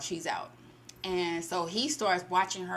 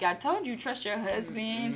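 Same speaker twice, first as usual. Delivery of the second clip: smug husband; 4 semitones higher than usual. Retold or imitated. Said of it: "And he was like".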